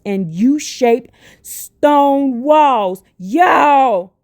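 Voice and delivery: real excited shout, words stretched out; excited scream. Real, not acted. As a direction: cry it out loud and slow, amazed. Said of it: "I don't mean to scream".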